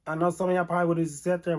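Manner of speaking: ordinary talk, nothing marked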